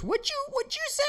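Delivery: high, mocking girl voice; girl's voice. A woman snapping at him across the pool, in a high mimicking voice. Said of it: "some girl goes".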